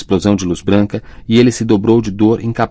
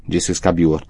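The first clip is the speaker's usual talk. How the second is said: usual speaking voice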